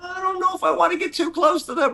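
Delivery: high nervous voice; hammed-up nervousness; mock nervous. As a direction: play scared of sharks. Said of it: "I'm like".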